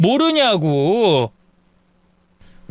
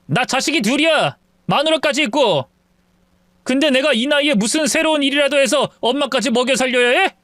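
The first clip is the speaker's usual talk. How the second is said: ordinary delivery, nothing marked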